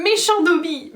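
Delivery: high, loud self-scolding elf cry; shouting at himself. A narrator's reading. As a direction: cry out while punishing himself. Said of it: "en criant".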